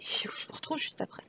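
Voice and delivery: whispered sign-off; soft unvoiced farewell. Real, not acted. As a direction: breathe it out to the viewers. Whispered outro to viewers.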